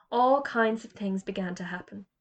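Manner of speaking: ordinary delivery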